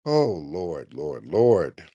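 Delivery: low, weary lament; worn-out muttering to the heavens; exasperated. Real, not acted. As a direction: sink deep in pitch with each appeal. Exasperated after dropping the caller.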